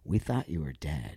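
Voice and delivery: low, soft, hushed voice; stunned hush. Retold or imitated. Mom to long-lost child on phone, hushed and low. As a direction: retell it quietly, as a shocked mother. Mother's stunned words, retold.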